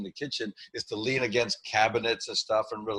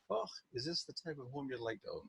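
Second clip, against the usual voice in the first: soft, breathy-free Scottish granny lilt, much quieter; Mrs. Doubtfire Scottish; 3 semitones higher, 11 dB quieter than usual. Shown as a demonstration. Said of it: "it comes out like Missus Doubtfire for me".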